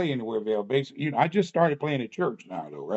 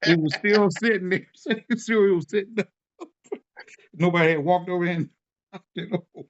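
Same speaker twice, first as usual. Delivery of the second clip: laughing; 4 semitones higher than usual. Real, not acted. Laughing while telling.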